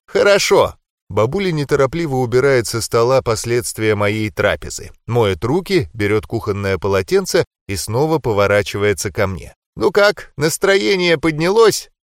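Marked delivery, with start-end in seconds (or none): 0.1-0.8 higher, sweet grandma voice. Acted. Grandma answers. 9.8-11.9 higher, sweet grandma voice. Acted. Grandma asks kindly.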